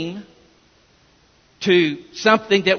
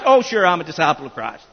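lower, fast and offhand, a smug shrug of a reply; mock complacent answer; 5 semitones lower, faster than usual. Shown as a demonstration. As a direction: toss it off like a self-satisfied churchgoer. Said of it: "No, no, no".

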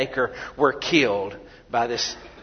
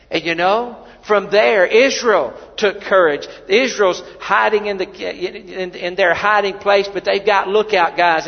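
loud, higher, driving sermon delivery; preaching at full force; 6 semitones higher, 7 dB louder than usual. Acted. Preacher telling the congregation of the battle, loud and driving. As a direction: build the fire in the pulpit.

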